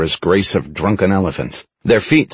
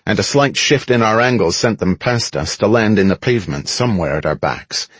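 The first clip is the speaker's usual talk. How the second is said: usual speaking voice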